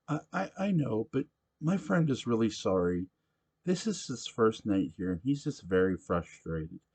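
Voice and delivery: nervously smooth things over; nervous young girl. Acted. Quinn nervously pleads with Sam.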